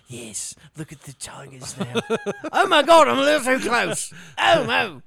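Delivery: hushed documentary voice turning to panicked yelps; mock nature host. Friend mocking a TV host near tigers, hushed then yelping. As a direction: play a wildlife host who gets too close.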